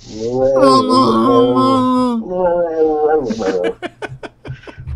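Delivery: long, high, wavering mock Wookiee howl; badly faked Chewbacca roar; terrible Yeti roar. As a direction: attempt a drawn-out creature bellow. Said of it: "I'm terrible at Yeti".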